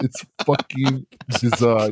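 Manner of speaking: ordinary talk, nothing marked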